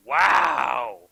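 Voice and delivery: loud wow. Real, not acted. GM reacts to both low rolls.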